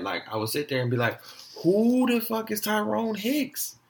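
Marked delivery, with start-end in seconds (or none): 1.6-3.8 high, drawn-out mock outrage; retold indignation. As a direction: re-enact being pissed at the wrong name. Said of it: "I would get so mad".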